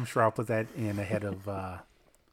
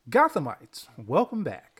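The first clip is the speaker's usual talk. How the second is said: higher, louder welcome to listeners; excited greeting; 5 semitones higher, 5 dB louder, much slower than usual. Real, not acted. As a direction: greet the audience with a big lift. Show greeting.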